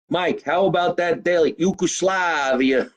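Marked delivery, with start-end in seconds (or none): 1.6-2.9 "Yugoslavia" drawn out slowly in a New Jersey accent. Shown as a demonstration. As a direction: say "Yugoslavia" slow and Jersey-style. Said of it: "loves when I say the word Yugoslavia".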